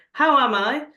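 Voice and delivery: snapped, loud and high; snapping. Retold or imitated. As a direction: bite back with the question. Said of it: "wants to snap".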